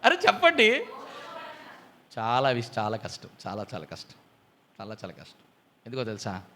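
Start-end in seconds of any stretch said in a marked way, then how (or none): 2.2-6.4 much softer, lower, almost under the breath; hushed emphasis. Acted. Preacher telling the church how hard patience is, hushed. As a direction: drop the voice to stress how hard it is.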